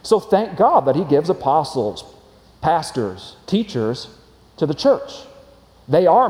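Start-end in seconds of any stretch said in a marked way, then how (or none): none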